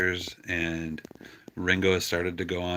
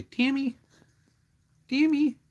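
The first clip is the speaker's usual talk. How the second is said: very high sing-song call of the name; calling the kitten; 6 dB louder than usual. Shown as a demonstration. Owner showing how he calls his kitten, very high sing-song call. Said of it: "So I say".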